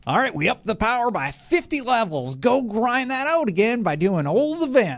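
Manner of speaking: put-on high, wheezy old prospector; old timer miner voice. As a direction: cackle it out like a grizzled miner. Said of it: "I don't know why I'm doing the old timer minor voice".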